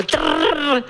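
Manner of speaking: long rolled trilled "trrr"; imitated rolling trill. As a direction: roll the tongue like a whirring machine. Imitating the teaching machine noise.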